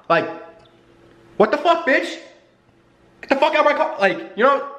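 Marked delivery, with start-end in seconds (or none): 1.4-4.0 high, heated cursing outburst; angry shout. Real, not acted. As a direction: bark it at her in fury. Shouting at the girl.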